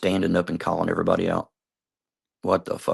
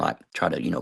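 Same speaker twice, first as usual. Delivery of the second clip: ordinary talk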